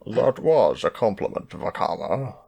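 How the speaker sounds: a hint of a famous actor's voice; lightly attempted celebrity impression; Sean Connery. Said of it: "That was more like Sean Connery".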